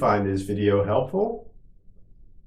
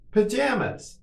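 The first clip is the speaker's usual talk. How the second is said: much higher, shorter take; quicker model; 12 semitones higher than usual. Shown as a demonstration. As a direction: say it quickly on a raised pitch. Teacher models word for repetition.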